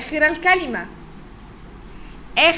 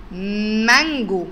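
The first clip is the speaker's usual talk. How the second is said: slow drawn-out word; much slower than usual. Shown as a demonstration. Teacher stretches مانجو for the children to listen. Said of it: "فكر كده كويس واسمع كويس قوي".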